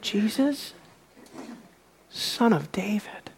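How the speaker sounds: soft, halting near-whisper; tentative hush with a long pause; hushed utterance. Acted. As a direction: say it very softly, fading into a whisper. Beggar's first words, voiced softly.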